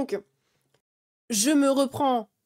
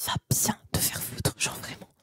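whispered insult; 5 dB quieter than usual. Real, not acted. Mouths off at a chat critic.

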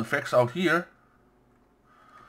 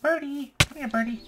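high sing-out call to a little pet; high-pitched birdie call; coaxing a bird; 12 semitones higher than usual. Pretend. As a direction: call it over in a high, small voice. Calling a bird in game.

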